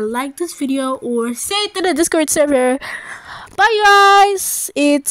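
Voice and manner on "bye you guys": loud, slow sign-off; loud drawn-out goodbye. Real, not acted. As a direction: call out the goodbye slowly. Loud drawn-out outro farewell.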